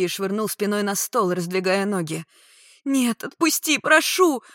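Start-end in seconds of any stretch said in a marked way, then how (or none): 2.8-4.4 high, loud begging; frantic pleading. A narrator's reading. Woman thrown on table, pleading frantically. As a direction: rise to a cry on the last word.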